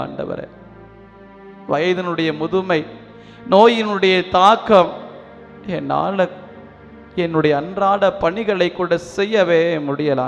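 usual speaking voice

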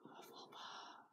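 breathy hushed whisper of Hufflepuff; soft reverent whisper. Shown as a demonstration. Whispered echo of the house name.

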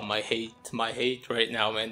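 usual speaking voice

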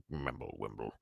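very low, quiet mumbling; whispered mumble. A narrator's reading. Pale uncle answers the furious giant, whispering. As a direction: mumble nonsense under your breath. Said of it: "whispered something that sounded like".

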